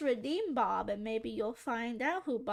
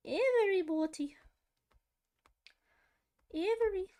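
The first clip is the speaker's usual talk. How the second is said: posh British woman; 6 semitones higher, 4 dB louder than usual. Retold or imitated. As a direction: stretch it out like a prim British lady. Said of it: "Who am I copying now?".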